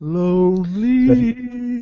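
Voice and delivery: high, slow drawn-out wail; mock lonely wail. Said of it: "Daryl's feeling quite lonely".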